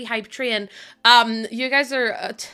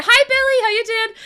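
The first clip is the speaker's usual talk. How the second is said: high, loud hello; bright greeting; 10 semitones higher, 5 dB louder than usual. Real, not acted. Streamer greeting a viewer in chat, bright. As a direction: call out a cheery hi.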